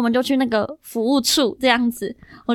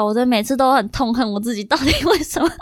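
usual speaking voice

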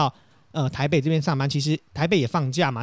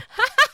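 loud laugh; 4 dB louder than usual. Real, not acted. Laughing at rolling story.

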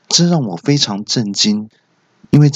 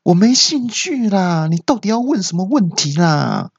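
imitated exasperated cry; 9 semitones higher than usual. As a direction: wail in exasperation like the nurse. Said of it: "那护士就大叫说". (学护士尖着嗓子大叫)

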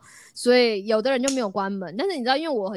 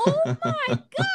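音调拔高很多、拖长地说; 像美国人一样夸张尖声惊叹; 美国式高八度惊呼; much slower than usual. Retold or imitated. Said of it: "美国高八度". (模仿美国人高八度地喊Oh my god)

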